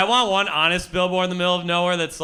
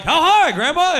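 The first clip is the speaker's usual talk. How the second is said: eager; 13 semitones higher, 7 dB louder than usual. Retold or imitated. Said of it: "We're like".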